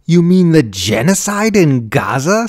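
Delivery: loud, high bellow; incredulous, anguished shout; outraged bellow. A narrator's reading. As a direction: bellow it in disbelief. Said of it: "Susan bellowed".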